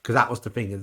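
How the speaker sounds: ordinary talk, nothing marked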